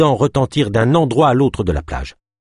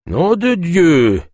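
slow, higher-pitched grumbled curse; mild growled oath; 8 semitones higher, much slower than usual. A narrator's reading. Freed trapper Kolb cursing, lightly growled. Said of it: "grogna Kolb".

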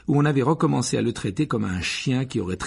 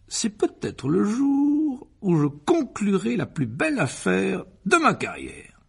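high, slow, swelling businessman's boast; pompous Uncle Vernon, grandiose; 10 semitones higher, 4 dB louder, slower than usual. A narrator's reading. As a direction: stretch each phrase with self-importance. (oncle pompeux, solennel et exalté)

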